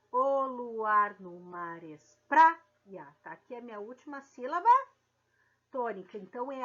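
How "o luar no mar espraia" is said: slow, even read of the verse. Shown as a demonstration. Teacher rereads the verse for the class, slow. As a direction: read it through calmly. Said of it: "vamos fazer a separação poética ou métrica".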